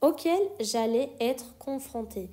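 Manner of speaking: auquel j'allais être confrontée, very slow and separated; slow repeat. Shown as a demonstration. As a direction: spell each word out slowly for students. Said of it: "یه تیکه رو یه دفعه دیگه میذارم".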